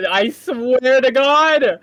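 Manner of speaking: loud, slow I swear to God; loudly accusing a friend of the prank; drawn-out yell. Real, not acted. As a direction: stretch each word into a yell.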